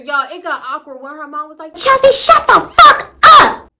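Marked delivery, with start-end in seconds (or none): 1.8-3.7 full-force high scream, drawn out; furious shout. Retold or imitated. Mom snaps at daughter in awkward silence, screaming. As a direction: explode at your child at the top of your voice.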